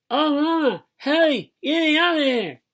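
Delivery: very high, loud, stuck-lipped wail; high desperate hollering; panicked wailing. A narrator's reading. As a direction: wail for Mama to rescue you. Stuck boy acted crying for help.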